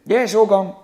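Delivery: very loud, high yobbish heckle; acted punk yell; punk shout. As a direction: bellow it back at the interviewer. Acted punk answering back.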